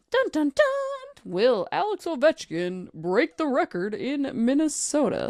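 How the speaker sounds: dramatic high news-anchor announcement; breaking news voice. Pretend. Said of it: "That's, like, my breaking news voice".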